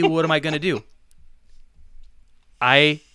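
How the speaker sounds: ordinary talk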